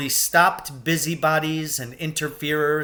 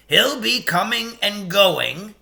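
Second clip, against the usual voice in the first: lightly voiced beaver, a bit higher and louder; a hint of a homely beaver; Mr Beaver's voice; 5 semitones higher, 4 dB louder than usual. A narrator's reading. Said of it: "he had said".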